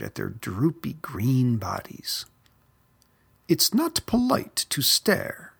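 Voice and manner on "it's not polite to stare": high prim scolding; tut-tut at a rude youngster, pitched up; chiding. A narrator's reading. Old snake Aggie scolding young Verdi, high and prim. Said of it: "chided Aggie".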